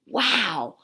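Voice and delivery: lively, expressive storybook voice; reading to children. Acted. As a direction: read it to a class of young kids. Reads picture book to children.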